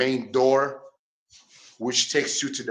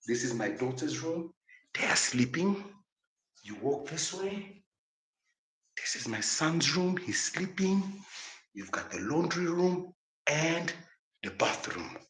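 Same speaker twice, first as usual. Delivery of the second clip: hushed whisper; 4 semitones higher, 10 dB quieter than usual. Real, not acted. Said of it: "I have to whisper because my kids are sleeping".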